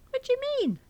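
very high, incredulous query; high-pitched bafflement; baffled question. Acted. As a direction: squeak it out in puzzlement. Friend baffled by the complaint.

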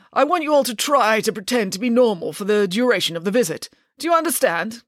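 prim, pompous father laying down rules; a fussy lawyer giving orders; father instructing the children before a visit, pompous; stiff lawyer father. Acted. Mister Green voiced.

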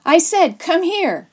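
high, loud repeated order; bully calling out a command; insistent bully. A narrator's reading. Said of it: "he repeated".

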